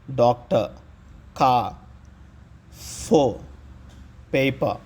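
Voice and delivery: డాక్టర్ and పేపర్ ending in an open "ah", no r; silent final R. Shown as a demonstration. Teacher giving examples to learners, డాక్టర్ and పేపర్ without final r. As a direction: drop the r from the end of డాక్టర్, కా, ఫో, పేపర్. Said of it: "చివరి ఆర్ను పలకము".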